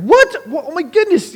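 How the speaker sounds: high, loud burst of disbelief; re-enacted stunned outburst; freaking out. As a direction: exclaim in acted shock, pitch leaping right up. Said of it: "freaking out".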